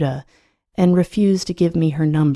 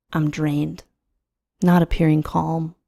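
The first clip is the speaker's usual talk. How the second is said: plain reading voice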